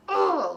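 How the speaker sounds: loud, high groan of annoyance; exasperated groan. Retold or imitated. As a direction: imitate being fed up with a sudden groan. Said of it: "I get pissed at him".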